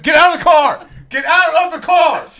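high, loud furious cop yell; cop shouting. Pretend. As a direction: scream the order at the driver. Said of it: "oh, you wanna act it out?".

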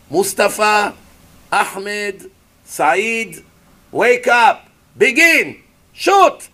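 rising to a shouted command; mock terrorist commander. Preacher voicing an enemy commander ordering rockets, shouting.

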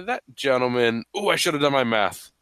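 normal talking voice

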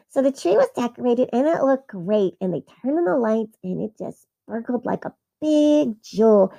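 ordinary delivery

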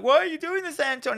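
high, pleading mock voice; frightened friend pleads, high and pleading. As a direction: squeal the plea, hammed up. Retelling Peanut's plea.

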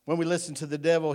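ordinary talk, nothing marked

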